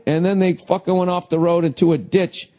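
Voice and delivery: ordinary delivery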